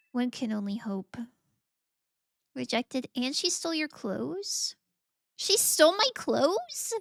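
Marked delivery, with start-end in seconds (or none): 5.4-6.9 high, loud, drawn-out disbelief; mock outrage. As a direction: act scandalised. Reading chat, outraged.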